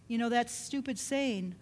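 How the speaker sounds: ordinary talk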